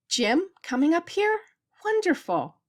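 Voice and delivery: high, astonished question into a delighted exclamation; light up with joyful disbelief, pitch leaping up; thrilled, high-pitched amazement; delighted surprise. Acted. Delighted at husband's news.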